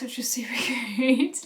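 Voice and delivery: breathy laughing whisper; whispered delight. Real, not acted. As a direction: whisper it, giggling.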